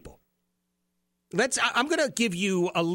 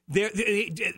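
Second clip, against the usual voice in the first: ordinary talk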